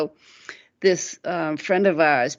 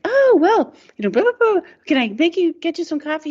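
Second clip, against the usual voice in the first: much higher, louder sing-song cheer; high fake hostess; 11 semitones higher, 4 dB louder than usual. Mother offers the neighbour coffee, shrill mock cheer. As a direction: chirp it like nothing happened. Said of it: "she's like".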